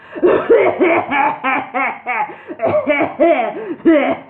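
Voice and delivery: high loud hacking laugh; laughing coughing fit. Acted. Wizard shakes with a loud high laughing cough. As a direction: laugh and cough till shaking. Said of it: "He coughed".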